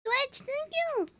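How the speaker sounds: high, drawn-out thank-you; high slow thanks. Real, not acted. Streamer thanking chat, high and slow.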